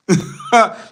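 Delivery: high, loud burst of laughter. Real, not acted. Amused at commenter's question.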